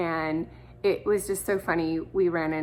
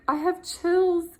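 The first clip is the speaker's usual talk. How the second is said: high, slow, choked-up retelling; moved, near tears; 7 semitones higher, slower than usual. Retold or imitated. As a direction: imitate her welling up with emotion. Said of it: "her eyes started welling up".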